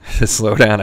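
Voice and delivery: normal talking voice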